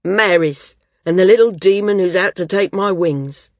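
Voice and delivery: big, loud welcome; booming. A narrator's reading. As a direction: hail the visitors at full voice. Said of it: "his voice loud as ever".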